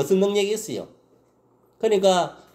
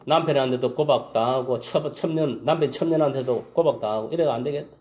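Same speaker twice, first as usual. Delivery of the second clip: ordinary talk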